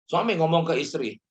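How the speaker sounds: ordinary talk, nothing marked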